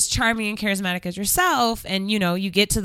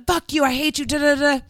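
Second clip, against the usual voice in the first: higher-pitched, rattled-off mock tirade; mock angry rant; 5 semitones higher than usual. Shown as a demonstration. Showing how to scare him away, mock angry rant. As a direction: play at yelling before the tone switch. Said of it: "switch tones".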